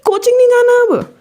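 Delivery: high-pitched imitation of a strict mom; angry mother. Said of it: "मेरी mummy का पहला reaction". (ऊँची आवाज़ में सख़्त माँ की नकल)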